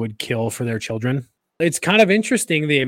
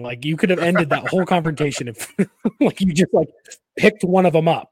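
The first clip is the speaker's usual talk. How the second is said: teasing through real laughter, voice raised; laughing teasing; 4 semitones higher, 3 dB louder than usual. Real, not acted. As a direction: laugh it out while ribbing a friend. Laughing while teasing Rory.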